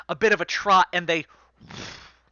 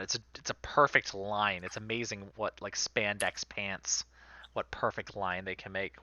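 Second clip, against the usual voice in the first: usual speaking voice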